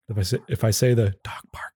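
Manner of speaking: ordinary delivery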